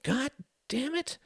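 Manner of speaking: inner curse. A narrator's reading. Ruth cursing to herself in embarrassment, inner voice. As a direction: swear inwardly, recoiling. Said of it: "she thought, drawing back".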